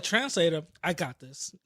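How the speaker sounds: soft, lightly voiced politician impression; Rudy Giuliani. Mocking a bad Spanish speaker, light politician impression. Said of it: "sound like Rudy Giuliani".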